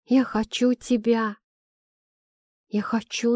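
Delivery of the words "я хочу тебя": plain reading voice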